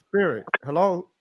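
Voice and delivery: ordinary delivery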